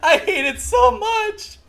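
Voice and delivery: high, loud wail through laughter; laugh-crying. Real, not acted.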